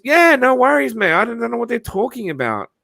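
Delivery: casual tradie. Retold or imitated. Fence guy voiced, retold.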